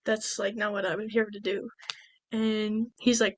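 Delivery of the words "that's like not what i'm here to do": soft, understated objection; mild retold refusal. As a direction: object quietly, voice dropped. Retold Padme first refuses his offer.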